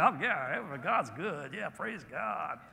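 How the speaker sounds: soft, slow, put-on happy churchy voice; sugary mock praise, trailing off soft; mocking fake euphoria. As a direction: send up the always-smiling believer. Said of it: "people who always have this euphoric smile".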